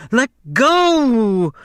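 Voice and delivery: high, loud cry. A narrator's reading. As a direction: shout it in alarm. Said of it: "she cried".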